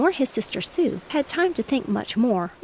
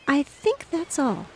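a touch of mock gravity, slow; slowly, like a little girl playing grown-up; understated grave tone, slower; 6 semitones higher than usual. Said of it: "said Sue gravely".

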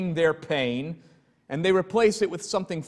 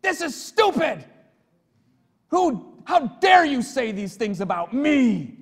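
retold angry shout; 8 semitones higher than usual. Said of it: "and he shouted at me".

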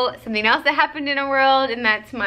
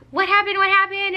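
high, anxious retold question; lightly acted fright, high and urgent; mock alarm; 9 semitones higher than usual. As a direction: replay her panic, pitched up. Retelling her alarmed reply.

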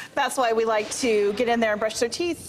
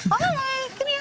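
soothing a scared dog; 13 semitones higher, slower than usual. Real, not acted. Vet calming dog.

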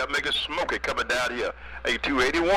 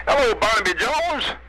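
raised, higher radio hail; calling out; 6 semitones higher, 6 dB louder than usual. Real, not acted. Hailing a fellow operator on the radio, calling out. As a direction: hail him loudly with a lift in pitch.

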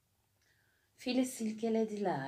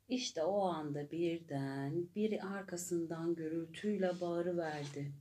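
ordinary delivery, nothing marked

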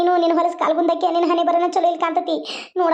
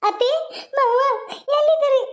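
high-pitched call for the in-laws; raised-pitch summons, word by word; 7 semitones higher than usual. Acted. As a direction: call out for family in the house. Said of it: "ಯಾಕ ಹಂಗ್ ಬಡ್ಕೊಳಕತ್ತಿ".

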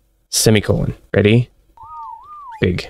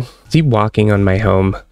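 normal talking voice

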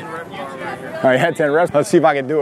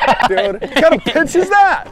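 surprised shout; 10 semitones higher, 6 dB louder than usual. Real, not acted. Reacting to wild pitch.

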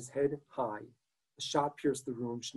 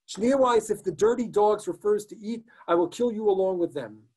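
higher, louder furious threat; threatening shout; 6 semitones higher, 4 dB louder than usual. Acted. SS man threatening Schneeweiss, furious shouted threat. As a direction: yell the threat down at him.

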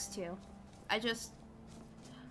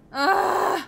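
loud high groan; frustrated groan; 9 semitones higher, 14 dB louder than usual. Real, not acted.

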